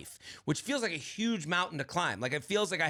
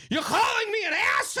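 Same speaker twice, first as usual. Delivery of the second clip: mock mom yelling high and loud with a laugh; indignant shout; 13 semitones higher, 5 dB louder than usual. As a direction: fire it back, offended and half laughing. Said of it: "And she goes".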